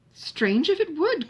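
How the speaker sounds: a high, slow, mildly heated outcry; a touch of youthful indignation; cried out. Acted. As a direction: lift the line in eager protest. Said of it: "cried Marianne".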